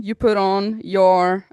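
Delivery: ordinary delivery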